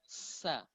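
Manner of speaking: "Sa" with a long whistling s; soft "Sa", letting the hiss ring; sofir on sin. Shown as a demonstration. Teacher demonstrates sin's sound, whistling s. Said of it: "suara merdu yang keluar".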